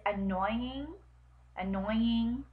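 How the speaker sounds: slowly sounded-out "annoying"; careful pronunciation. Shown as a demonstration. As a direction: test out "annoying", drawn out slowly. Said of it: "to pronounce".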